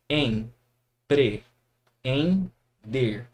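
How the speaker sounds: Em, pre, em, der spaced out one by one; syllable by syllable. Shown as a demonstration. Teacher to students, splitting empreender into syllables. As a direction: separate empreender again. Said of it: "Vamos lá, ó".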